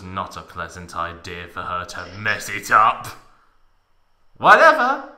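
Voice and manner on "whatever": high, loud mock-girly brush-off; mock Tifa dismissing it; hammed-up Tifa. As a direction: toss it off like a sulky girl. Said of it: "muttered Tifa".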